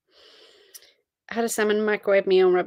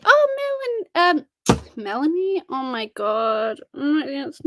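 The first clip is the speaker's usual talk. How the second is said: surprised; 6 semitones higher, slower than usual. Real, not acted. Suddenly noticing a viewer joined.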